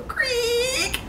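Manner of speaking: high, soft drawn-out creak; door creak. Acted. Villagers open the house door, high quiet creak. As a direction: imitate a slowly opening door.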